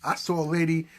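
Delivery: ordinary talk, nothing marked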